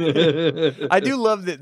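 very slow, words stretched through a real laugh. Real, not acted. Amused by the book's dated phrase, laughing. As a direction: laugh it out as you say it.

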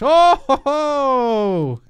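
loud, high mocking oho; triumphant whoop. Real, not acted. Gamer crowing after winning with his shovel, loud and high. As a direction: crow in triumph.

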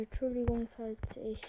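ordinary delivery

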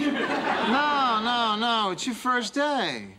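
high, drawn-out reassurance; indulgent reassurance. Acted. Boss brushing off the rookie's apology, indulgently reassuring.

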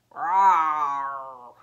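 loud low roar; bear roar. Acted. Bear bursting in with party hats, loud roar.

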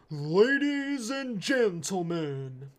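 put-on very loud, slow shout in a Russian accent; hammed-up Russian-accented stage announcer roar; Alex the Terrible. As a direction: bellow it with a Russian accent, stretching every word. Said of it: "in, like, a Russian accent".

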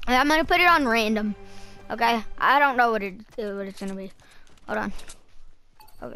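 usual speaking voice

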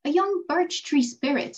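ordinary delivery, nothing marked

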